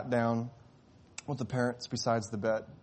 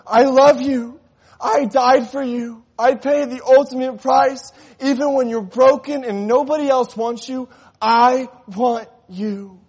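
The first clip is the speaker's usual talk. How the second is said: higher, louder impassioned sermon; full-force preaching; 12 semitones higher, 15 dB louder than usual. Acted. Pastor pleads with the congregation, preaching at full force.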